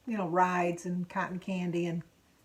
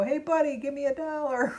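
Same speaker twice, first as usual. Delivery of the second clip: put-on kids asking; 6 semitones higher, 3 dB louder than usual. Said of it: "they go".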